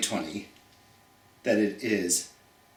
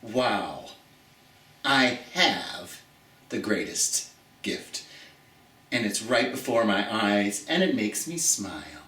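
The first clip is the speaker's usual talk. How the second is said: soft wonder; 6 semitones lower, 4 dB quieter than usual. Pretend. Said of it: "and say".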